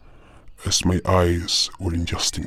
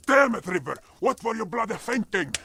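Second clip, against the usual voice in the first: exasperated scolding. Acted. Scolding River after crash.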